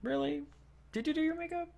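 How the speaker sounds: imitating a catty sarcastic courtier; mocking disbelief. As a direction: coo it with sarcastic fake interest. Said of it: "looks at her and goes".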